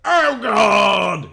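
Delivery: loud, high scream of pain; Oh God screamed out loud. Acted. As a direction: yell it like you're being poisoned. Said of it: "he screamed".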